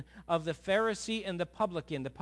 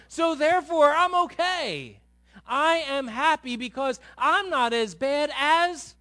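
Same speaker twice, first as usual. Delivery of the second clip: loud, high mocking sermon voice; fervent preaching; 10 semitones higher, 10 dB louder than usual. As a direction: hammer the point home. Preaching at full force.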